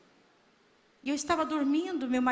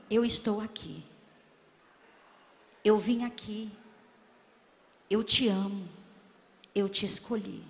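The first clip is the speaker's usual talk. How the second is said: a hint of God's voice, lower and measured; voz de Deus; 5 semitones lower than usual. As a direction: quote God solemnly, pitched down. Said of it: "E Deus falava assim". (voz de Deus, mais grave e solene)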